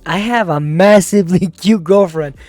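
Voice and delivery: loud, emphatic mock outburst; retold shout. Comey insists to his friend about his girlfriend, mock-shouting. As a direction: declare it loudly with a bit of a laugh. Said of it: "puts his hands on his desk and shouts".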